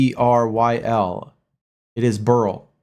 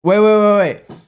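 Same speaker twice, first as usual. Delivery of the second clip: startled interruption; 8 semitones higher, 5 dB louder than usual. Real, not acted. Cuts in, stunned by the claim.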